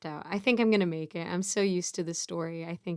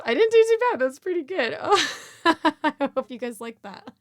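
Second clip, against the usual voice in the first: high pleased laugh; giggling with relief; amused; 7 semitones higher, 6 dB louder than usual. Real, not acted. Pleased after finishing story.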